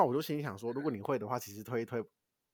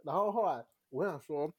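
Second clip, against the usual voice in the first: ordinary talk, nothing marked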